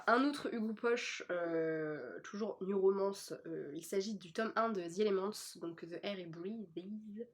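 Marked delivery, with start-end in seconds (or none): 5.9-7.3 slow, softer English title in a French speaker's accent; attempted English accent. Real, not acted. Vlogger reading out an English book title, attempted English accent. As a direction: draw out the English words, trailing softer. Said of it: "J'aime bien mon accent anglais".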